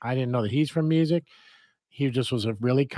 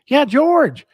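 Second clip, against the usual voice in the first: much higher, louder, falling on George; exasperated confirmation; 12 semitones higher, 9 dB louder than usual. Retold or imitated. Storyteller voicing the wife correcting him, emphatic exasperation. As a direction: insist on the obvious, mimicking her. Wife's exasperated reply in retold exchange.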